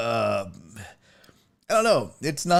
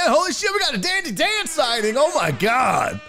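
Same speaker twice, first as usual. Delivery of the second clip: excited surprise; 8 semitones higher than usual. Real, not acted. Streamer spotting a regular viewer, excited surprise. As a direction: exclaim like spotting a rare celebrity. Spots a familiar viewer in chat.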